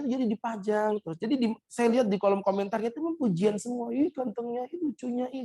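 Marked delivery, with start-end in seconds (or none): 3.9-5.4 gushing commenters. Retold or imitated. Imitating gushing Instagram commenters.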